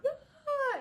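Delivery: high, loud cry breaking into a laugh; scream of pain. Retold or imitated. Retelling the contraction to viewers: a high pained cry. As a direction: yelp through the wave of pain. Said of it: "I'm like".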